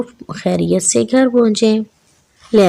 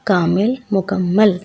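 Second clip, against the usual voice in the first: ordinary delivery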